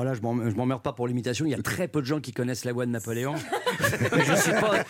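ordinary talk, nothing marked

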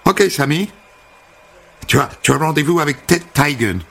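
high, bubbly American welcome; chirpy American hairdresser. Acted. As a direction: greet him like an eager stylist. Holly annonce le rendez-vous. (coiffeuse américaine enjouée, voix haute)

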